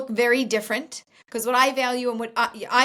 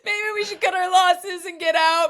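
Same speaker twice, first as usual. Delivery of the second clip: laughing, higher mock wail of a new bride; mock despair, laughing; 5 semitones higher, 3 dB louder than usual. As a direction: laugh through a hammed-up complaint. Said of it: "I'm like".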